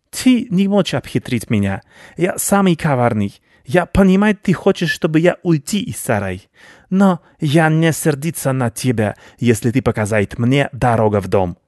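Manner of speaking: self-satisfied rodent baron; crafty rat baron. Acted. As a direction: play a pompous, cunning rat striking a bargain.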